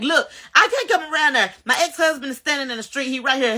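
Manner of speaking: high, urgent, laughing through it; retelling the frightened call, laughing; panicked call. Retold or imitated. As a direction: plead with the police, voice up.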